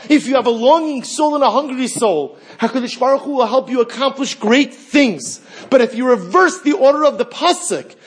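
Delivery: high, loud, driving sermon voice; preaching at full force. Acted. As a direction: preach it out to the congregation.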